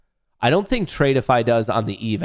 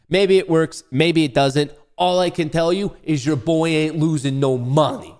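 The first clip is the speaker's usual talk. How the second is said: higher-pitched, put-on New York accent; 4 semitones higher than usual. Trader boasting to viewers he can't lose, New York accent. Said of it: "I'm speaking in New York accent".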